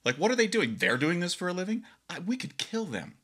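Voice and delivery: high, incredulous mockery; mock outrage. Grad students mocking the band's show, high scornful disbelief. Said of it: "we were just like".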